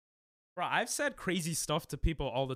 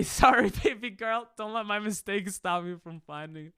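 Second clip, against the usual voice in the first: flirty mock apology; 4 semitones higher than usual. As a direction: play a cheesy flirt apologizing.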